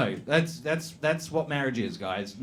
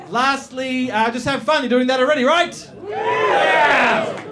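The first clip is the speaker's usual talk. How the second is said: higher and louder, building to a shout; hyped-up crowd call; 10 semitones higher, 6 dB louder, slower than usual. Real, not acted. MC hyping the comedy crowd before the act. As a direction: pump the room up.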